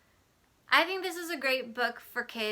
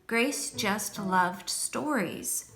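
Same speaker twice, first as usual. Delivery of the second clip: put-on calm storybook reading for children; story narration; 4 dB louder, slower than usual. A narrator's reading. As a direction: read it like a teacher at story time. Reads picture book aloud.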